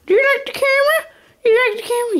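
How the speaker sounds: high, quick sing-song to a puppy; talking to a pet. Real, not acted. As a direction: coo at the dog. Talking to the dog.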